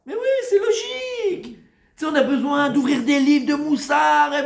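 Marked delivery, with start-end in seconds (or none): 0.1-1.6 very high, squeaky exclamation; mock obviousness. Rabbi mocking how obvious the saying is, squeakily. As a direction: squeal it in falsetto as self-evident. Acts reply to Ravashi's claim.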